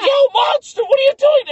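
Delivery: very high, loud squawk; alarmed yell. Retold or imitated. Visitor confronts a groping monster, yelling high and loud. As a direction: shriek your protest in comic outrage. Acting out startled protest at grope.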